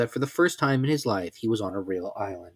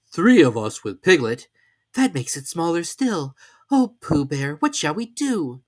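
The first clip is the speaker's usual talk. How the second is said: higher story voices; character voices; 6 semitones higher, 6 dB louder, slower than usual. A narrator's reading. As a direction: swap into the characters. Pooh and Robin voiced.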